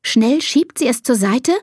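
ordinary delivery, nothing marked

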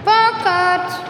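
calling out. Acted. Calling the beaver at his lodge.

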